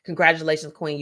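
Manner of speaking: normal talking voice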